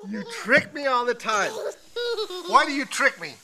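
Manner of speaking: high, laughing tease. Real, not acted. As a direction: laugh while scolding her playfully. Dad laughing at fake crying.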